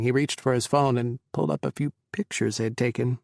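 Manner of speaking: usual speaking voice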